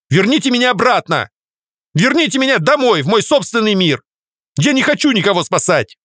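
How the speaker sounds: high, insistent demanding cry. A narrator's reading. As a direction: demand to be sent home. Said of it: "Требовательным голосом проговорила Варя".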